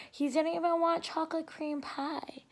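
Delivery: hushed. A narrator's reading. Narration dropped to a hush.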